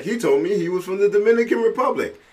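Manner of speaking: high, fast tattletale voice; friend's comment read out, high and quick; mock accusing quote. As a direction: rattle it off like a gleeful snitch. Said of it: "Mike Coleman is chiming in saying".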